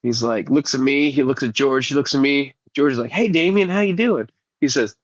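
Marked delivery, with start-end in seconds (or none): 3.1-4.3 higher-pitched imitation of a coworker; imitating a high-voiced buddy greeting a kid; George's voice. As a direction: pitch it up for a friendly hello. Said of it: "George has got a little bit of a higher pitched voice".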